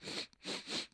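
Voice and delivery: quick repeated sharp sniffs; imitated coke sniffing. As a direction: sniff hard like someone on coke. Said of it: "or they're just like".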